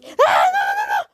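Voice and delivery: loud no no no; panicked gamer yell; frustrated shout. Real, not acted. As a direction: cry out as you die. Dying in the game.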